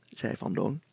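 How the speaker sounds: plain reading voice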